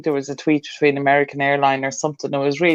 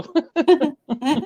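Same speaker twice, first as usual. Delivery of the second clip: mock Irish accent; 11 semitones higher, much slower than usual. Joining mocking accent.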